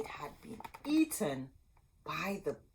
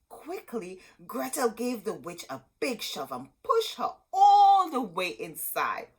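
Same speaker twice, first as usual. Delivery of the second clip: excited narration; 6 semitones higher, 9 dB louder than usual. A narrator's reading. Climactic shove.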